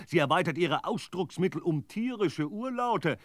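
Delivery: ordinary delivery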